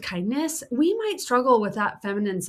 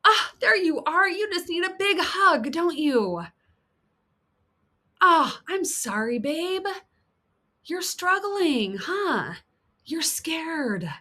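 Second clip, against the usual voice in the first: kind comforting voice; 8 semitones higher, slower than usual. Acted. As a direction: soothe a scared friend tenderly. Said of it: "is going".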